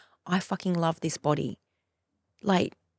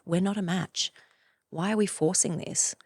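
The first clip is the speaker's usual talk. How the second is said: normal talking voice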